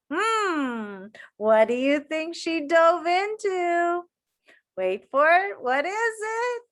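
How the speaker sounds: playful suspense. Real, not acted. Teasing kids with suspense.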